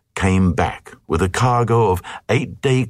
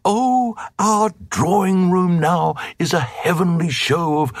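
much higher swooning queen showing off; gushing queen; 9 semitones higher than usual. Acted. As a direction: coo it like a proud grand lady. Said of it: "And the queen said".

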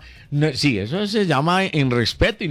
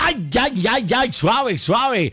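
high, startled ay ay ay; shocked exclamation; 7 semitones higher, slower than usual. Real, not acted. Host scandalized by caller's swearing, high startled outcry. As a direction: gasp it out scandalized, much higher.

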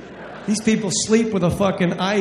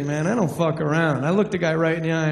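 normal talking voice

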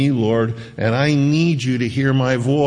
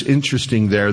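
ordinary delivery

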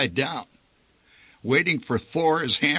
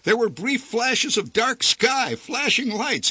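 ordinary delivery, nothing marked

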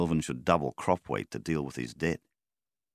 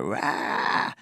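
higher mock yell "Rah!" from a retold bloke; imitated startled shout; 8 semitones higher than usual. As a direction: toss out a short startled "Rah!" as a retold jolt. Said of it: "Poor bastard would go".

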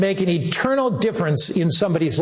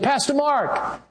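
high call from a distance; mock call from the balcony; 8 semitones higher, slower than usual. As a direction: call his name up high. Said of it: "He said".

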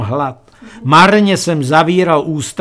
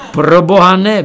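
higher, louder exclamation; narrated cry of refusal, moderately raised; cried-out refusal; 4 semitones higher, 6 dB louder than usual. A narrator's reading. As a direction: blurt out a comic protest. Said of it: "vykřikl jsem".

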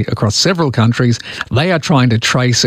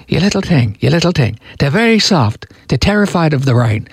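attempted Irish lilt, pitched up; Irish accent; 4 semitones higher than usual. Radio host imitating an Irish construction worker, mock Irish accent. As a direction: put on an amateur Irish accent. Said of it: "do it in a, uh, an Irish accent".

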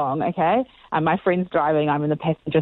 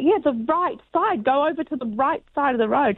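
higher, mock exasperated retelling; 9 semitones higher than usual. Retelling her exasperation at her friend, higher. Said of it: "And I was like".

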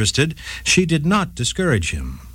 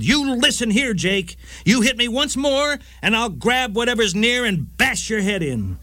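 angry yell; 8 semitones higher than usual. A narrator's reading. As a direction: warn him off in a raised, heated voice. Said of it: "she yelled".

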